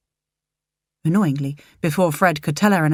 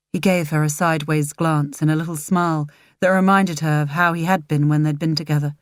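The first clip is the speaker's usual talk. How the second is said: ordinary delivery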